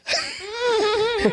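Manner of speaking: very high laugh near crying; real laughing fit; helpless laugh. Real, not acted. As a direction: break into a high squealing laugh. Laughing at own story.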